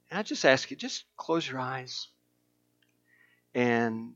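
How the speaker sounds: quiet, gentle call to prayer; soft prayer lead. Real, not acted. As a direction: guide them softly into prayer. Leads prayer, eyes closed.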